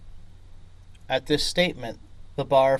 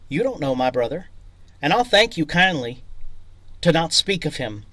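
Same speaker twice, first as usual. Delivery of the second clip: fast, much louder threatening command; angry order; 4 semitones higher, 7 dB louder, faster than usual. Acted. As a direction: warn him off, voice rising and hard. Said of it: "he ordered".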